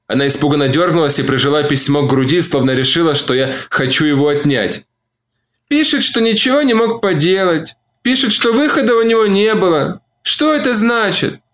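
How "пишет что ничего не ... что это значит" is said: distraught woman. A narrator's reading. Olivia distraught about letter.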